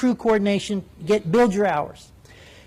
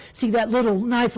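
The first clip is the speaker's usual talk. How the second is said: ordinary talk, nothing marked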